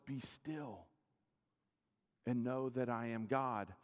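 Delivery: calm. Acted. Preacher quoting scripture to congregation, hushed and calm. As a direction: let the words settle gently. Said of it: "Scripture tells us".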